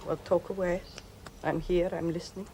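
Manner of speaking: an ailing wife in bed growing dizzy; weak invalid. Acted. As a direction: speak as if barely able to. Sick wife speaking weakly.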